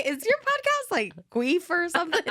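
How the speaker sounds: high-pitched incredulous question; mock questioner. Imagined listeners asking about the podcast name, high mock question.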